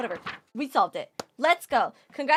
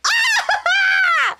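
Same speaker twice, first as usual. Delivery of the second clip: high, loud anguished yell; shrill frustrated shout; frustrated scream; 7 dB louder than usual. Real, not acted. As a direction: scream at your own mistake.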